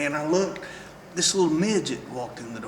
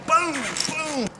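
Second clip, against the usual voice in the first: mock punch sounds; 11 semitones higher than usual. Imitating punches landing.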